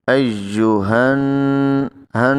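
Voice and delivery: ہن held long through the nose, slow; nasal ghunna. Shown as a demonstration. Teacher demonstrating ghunna, drawn out. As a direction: hum the noon in ایوہن long. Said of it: "آواز کو ناک میں لمبا کرنا ہے".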